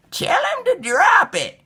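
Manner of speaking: high, loud urging granny; grandma voice. A narrator's reading. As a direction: call out like an eager grandma. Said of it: "Grandma said".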